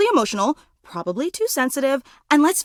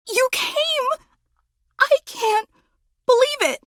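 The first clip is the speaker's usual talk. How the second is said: high-pitched, overcome with emotion; halting, overjoyed disbelief, pitched way up; emotional, blubbering; 11 semitones higher than usual. Acted. Lovesick fan greeting their hero, blubbering with emotion. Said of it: "immediately getting all emotional, blubbering".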